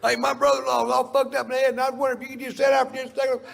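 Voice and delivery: high, fast, loud pestering fan; pushy fan. Retold or imitated. Stranger begging Doc for help, high, fast and loud. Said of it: "somebody going".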